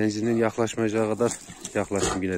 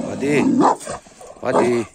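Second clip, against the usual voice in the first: sharp, louder call to a dog; firm command; 7 dB louder than usual. Real, not acted. Stopping Çomar bothering the puppy, firm loud command. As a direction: snap the dog out of it. Scolding dogs off each other.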